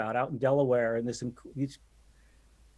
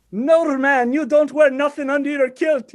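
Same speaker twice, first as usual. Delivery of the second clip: imitating a thick Scottish accent, higher and louder; 12 semitones higher, 11 dB louder than usual. Retelling the Scottish fan's kilt question in a thick Scottish accent. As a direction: put on a broad Scottish accent for the Scotsman. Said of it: "this thick accent is".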